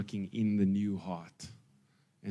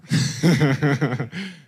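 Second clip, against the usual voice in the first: big burst of laughter, higher; loud laugh; 4 semitones higher, 8 dB louder than usual. Real, not acted. Preacher cracking up at the spanner story punchline, loud laugh.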